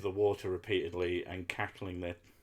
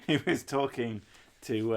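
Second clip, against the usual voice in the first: normal talking voice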